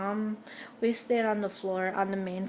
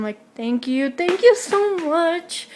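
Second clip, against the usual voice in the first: gushing thanks; 8 semitones higher, 6 dB louder, slower than usual. Retold or imitated.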